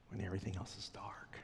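breathy whispered close. Real, not acted. Preacher ending the thought for the congregation, whispered. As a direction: whisper it into the dark. Sermon closing hush.

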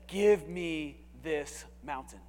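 slow, drawn-out, every word weighted; slow King James reading. Shown as a demonstration. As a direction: read it slowly like old scripture. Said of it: "I love how the King James says".

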